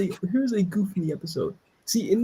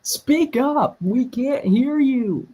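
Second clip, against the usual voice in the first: higher, drawn-out call to speak up; 5 semitones higher, much slower than usual. Pretend. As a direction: call out slowly to someone barely audible. Heckling a too-quiet character.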